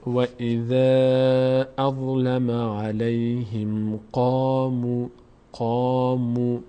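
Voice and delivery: و اذا اظلم علیهم قاموا recited slowly; slow tajwid recitation. Shown as a demonstration. Teacher recites the line for students. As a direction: recite with every madd held. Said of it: "بریم خط قبلی".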